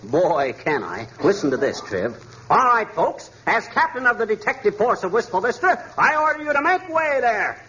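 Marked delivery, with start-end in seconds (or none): 2.5-7.6 higher, commanding police-captain voice, hammed-up; pompous mock order to clear the way. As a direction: play the big-shot captain ordering the crowd back. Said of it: "give orders in a loud tone of authority".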